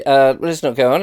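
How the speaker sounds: ordinary delivery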